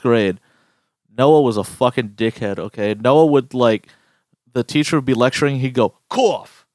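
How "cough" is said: spoken word cough, pitched up; fake cough word. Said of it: "say it like he was coughing".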